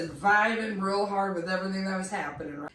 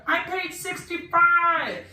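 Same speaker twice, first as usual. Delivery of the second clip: much higher, louder, slow call; shouting from the back; 10 semitones higher, 7 dB louder, slower than usual. Retold or imitated. Guy in the back topping her price, loud shout. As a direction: yell it from the back of the crowd. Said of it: "some guy from the back went".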